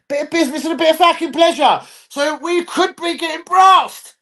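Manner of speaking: high, excited, hammed-up delivery; gleeful high-pitched announcement; playful high voice. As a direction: sing out the plan, stretching the end. Acts out gleeful plan.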